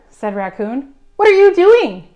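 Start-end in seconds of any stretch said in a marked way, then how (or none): 1.2-2.1 high, loud and curious; eager loud question. Acted. Raccoon asks passing Bird, eager high question. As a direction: pipe up loudly with keen interest.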